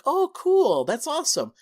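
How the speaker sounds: high, loud gushing reaction; mock-enthusiastic approval; imagined delight. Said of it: "expecting him to be like".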